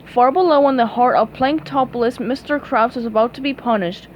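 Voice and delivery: ordinary delivery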